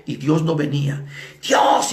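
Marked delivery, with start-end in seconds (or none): 1.4-1.9 imitated yell to God; shouting. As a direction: cry it out as a loud challenge. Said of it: "y gritó".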